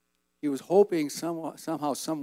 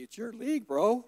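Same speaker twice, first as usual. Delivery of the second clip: raised-pitch retold complaint; mock protest; 9 semitones higher than usual. Player griping to Wayne about the referee, mock protest. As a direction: protest to a rival, playfully imitated.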